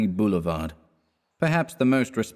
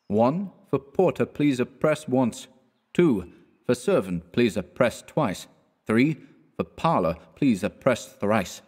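slow notice reading; 3 semitones higher, slower than usual. Acted. Reading a printed door notice.

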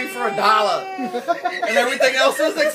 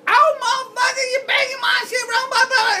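imitated angry yell; 14 semitones higher than usual. Girls scolding the begging dude, high mock angry yell. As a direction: tell him off, hammed up and laughing.

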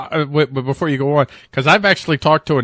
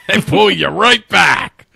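drawn-out, higher-pitched punch through a laugh; sudden dramatic yank; 6 semitones higher, 5 dB louder than usual. Acted. Host acting out the leash yanked back, dramatic emphasis. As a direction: haul each word out with effort, slowly.

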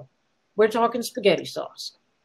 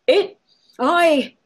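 stammering; 6 semitones higher, 4 dB louder than usual. A narrator's reading. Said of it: "the pretty boy tried to talk".

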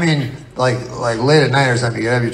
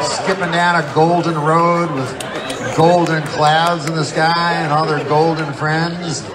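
ordinary delivery

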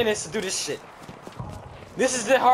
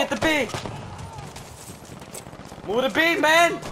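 urgent shout; 9 semitones higher than usual. Real, not acted. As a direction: yell at the team to move. Urging team.

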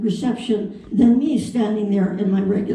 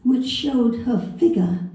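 storybook British accent, unhurried and gentle; English dialect. Shown as a demonstration. Reading Peter Pan to the audience in a mild British accent. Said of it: "in an English dialect".

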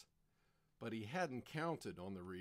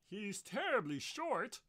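high-pitched whine; whining; 10 semitones higher, 3 dB louder than usual. Acted. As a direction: complain like a sulky tenor. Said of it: "whined Manicotti".

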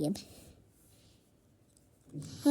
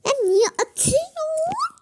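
higher, louder protest with a rising "atıyorsun"; indignant protest; 13 semitones higher, 3 dB louder than usual. Pretend. Sister protesting a throw, high indignant squeal. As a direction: squeal at the unfairness.

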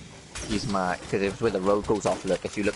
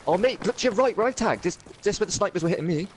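excited callout; 9 semitones higher than usual. Real, not acted. Warning teammate of enemy.

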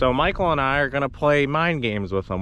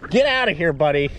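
shooing yell; 6 semitones higher, 4 dB louder than usual. Real, not acted. Shooing dolphin away.

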